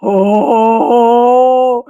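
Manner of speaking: excited gasp and long oh; delighted gasp. Real, not acted. Showing off the light.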